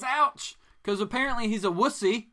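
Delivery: high, loud scoffing; mock the wimp with a sneer. Real, not acted. Host mocking the character for saying ouch.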